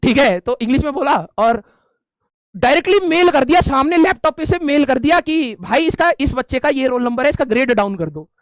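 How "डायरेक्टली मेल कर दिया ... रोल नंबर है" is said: ordinary talk, nothing marked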